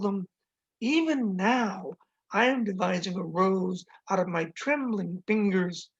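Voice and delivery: slow, deliberate poem recital; measured, emphatic poetry voice; poem reading. Acted. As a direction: recite a love poem, savoring each phrase. Reads own poem aloud.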